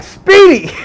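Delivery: high, loud call. Retold or imitated. Crew calling him Speedy, high and loud. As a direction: shout the name.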